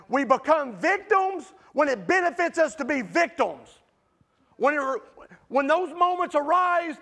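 fiery pulpit delivery, climbing to a shout; full-force preaching. Acted. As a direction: preach it hard to the congregation.

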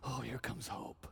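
put-on hushed child's thought. Neighborhood kid seeing you come, soft slow hush. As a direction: breathe it like a hopeful child. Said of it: "sees you coming and going".

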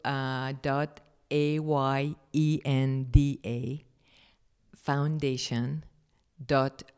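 slow, letter-by-letter dictation; careful slow spelling of a website. Real, not acted. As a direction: spell the web address slowly for the listener. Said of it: "How do you spell that?".

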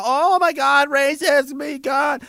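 mocking whine. Mocking a fragile society's complaints.

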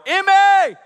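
loud high call across a store; shouting a name. Retold or imitated. Dad calling for his daughter across the store, loud and high. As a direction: yell for your lost daughter.

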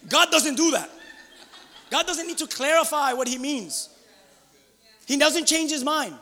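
loud, high, emphatic preacher's declaration; forceful preaching. Real, not acted. Preacher assures the congregation God's love holds, forcefully. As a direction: proclaim it to the whole room.

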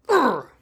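loud, high rolled growl; hammed-up "urrr" of brute power; mock macho grunt. Vlogger showing off protein powder, loud mock grunt.

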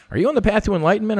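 high, fast mock questioner voice; mocking seeker. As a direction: parody an earnest spiritual seeker, quick and high. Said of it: "people are like".

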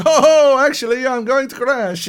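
high, loud wail in mock twee British; hammed-up British pilot in comic alarm; twee British accent. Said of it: "talking this fucking twee British accent".

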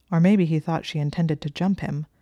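ordinary delivery

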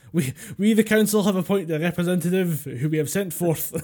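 council representative. Pretend. Council envoy addressing an actor, mock-formal declaration. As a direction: proclaim it like a pompous town herald. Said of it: "just a pointer representative just going".

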